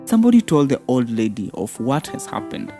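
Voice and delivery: ordinary delivery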